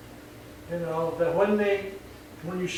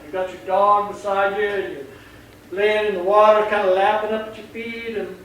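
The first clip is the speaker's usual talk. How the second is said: ordinary delivery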